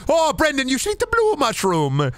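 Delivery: very high, wheedling gnome voice; squeaky gnome, stretching out the last words. Acted. Suspected gnome coaxing Brendan to eat, high squeaky gnome voice. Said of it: "I do not fucking trust like".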